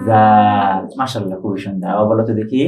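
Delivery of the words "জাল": জাল with tongue between the teeth, long aa; pronunciation demo. Shown as a demonstration. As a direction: model the dental fricative in জাল, then stretch the vowel. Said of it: "দুই দাঁতের মাঝখানের ফাঁকা জায়গায়".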